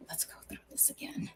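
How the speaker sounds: barely audible whisper, under the breath; hushed self-talk; whispering to herself. Real, not acted. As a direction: whisper it privately as you check your notes. Muttering to herself while looking up title.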